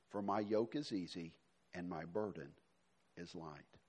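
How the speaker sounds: low, soft, gentle reading of Jesus' words; soft scripture reading. Acted. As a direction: read it quietly, like an invitation. Reads Jesus' words softly.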